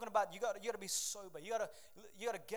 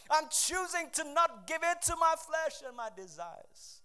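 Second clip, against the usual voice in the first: loud, driving sermon delivery; full-throated pulpit preaching; preaching at full force; 5 semitones higher, 10 dB louder than usual. Acted. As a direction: build the congregation up with fire.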